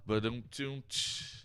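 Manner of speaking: slow, soft spoken rimshot; softly mouthed ba-dum-tsh; vocal drum rimshot. Retold or imitated. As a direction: imitate a joke's drum sting. Imitates drum sting after own joke.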